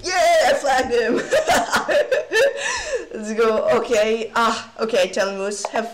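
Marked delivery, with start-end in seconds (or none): 1.2-3.1 high-pitched gleeful giggle. Real, not acted. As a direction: giggle in delight at the win. After flagging opponent.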